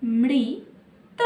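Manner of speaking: Amrit said slowly, syllable by syllable; slowed to syllables. Shown as a demonstration. Teacher demonstrating a three-letter word to children, slowly. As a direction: draw Amrit out for the class. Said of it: "तीन अक्षर में क्या है?".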